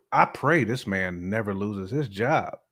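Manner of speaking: ordinary talk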